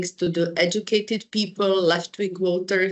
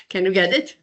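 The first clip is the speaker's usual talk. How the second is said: normal talking voice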